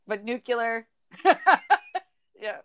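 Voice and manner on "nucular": "nuclear" as NOO-kyuh-ler; casual "nucular" for "nuclear"; colloquial nuclear. Shown as a demonstration. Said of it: "I just said it right".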